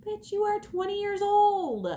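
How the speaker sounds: high put-on voice mocking a young person; high mocking quote voice. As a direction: squeal it like a scornful sister. Mocking someone's naive claim.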